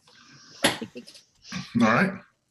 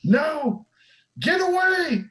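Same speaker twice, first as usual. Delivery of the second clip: rising, ever higher panicked cries; terrified scream; slower than usual. Acted. GM voicing the trapped woman screaming at the attacker, rising panic. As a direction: climb higher with each plea, terrified. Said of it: "She screams".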